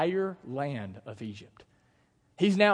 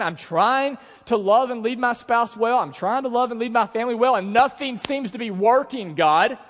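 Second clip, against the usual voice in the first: raised, loud, desperate plea to God; pleading prayer; 6 semitones higher, 7 dB louder than usual. Acted. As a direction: pour out frustration to God.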